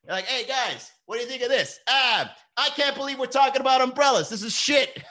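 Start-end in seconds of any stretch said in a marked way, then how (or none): 0.2-1.8 high, eager-to-please pitch; high-pitched hammed-up enthusiasm. Acts himself pitching to viewers. 1.9-5.0 high, loud hammed-up complaint; exaggerated high-pitched disgust; mock outraged viewer. Acts a viewer's outraged reaction.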